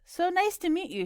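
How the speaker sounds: high-pitched, halting non-native English; careful, halting English of a Spanish speaker. A narrator's reading. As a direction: greet in hesitant foreign English. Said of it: "she said in halting English".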